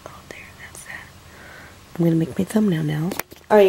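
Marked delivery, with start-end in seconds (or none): 0.1-1.1 whisper. Real, not acted. Baby asleep, mom whispering.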